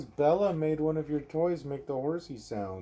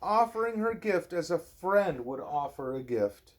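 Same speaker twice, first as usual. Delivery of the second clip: lilting bedtime-story read-aloud; reading to a child; 6 semitones higher, 5 dB louder than usual. Acted. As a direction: read it gently to a little one at bedtime. Reading a picture book aloud.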